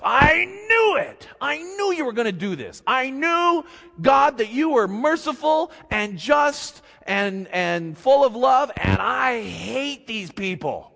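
high, loud, indignant complaint; angry Jonah. Acted. Jonah complaining to God, high and indignant. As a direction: rail at God in frustration. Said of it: "Jonah goes".

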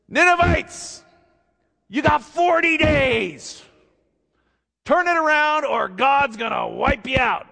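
high, loud prophet's cry; Jonah's doom warning. Acted. Jonah calling out to the Ninevites, high and loud. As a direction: cry out judgment to the whole city.

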